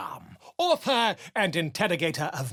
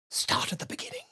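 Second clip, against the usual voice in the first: whispered prompt; 7 dB quieter, faster than usual. Acted. Quiet urging to the hero.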